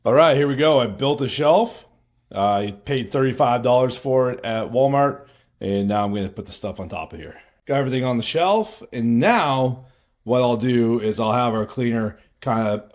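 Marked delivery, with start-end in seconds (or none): none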